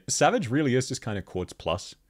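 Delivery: ordinary talk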